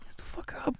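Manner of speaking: hissed whisper; urgent whisper to wake him. Acted. Friend waking dazed Darwin, urgent whisper.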